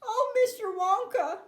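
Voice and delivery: high, pleading wail; distressed wail. A narrator's reading. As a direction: wail it beseechingly. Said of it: "wailed Missus T V".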